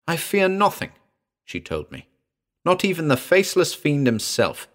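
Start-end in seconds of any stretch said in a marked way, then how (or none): 0.1-0.9 high, bold heroine's voice; strong, clear. A narrator's reading. Jungle girl to frightened man, strong and clear. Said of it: "girl's voice rose strong and clear". 2.6-4.7 put-on high, defiant girl's boast; strong, clear. A narrator's reading. Jungle girl scorning the fiend, strong and clear. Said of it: "girl's voice rose strong and clear".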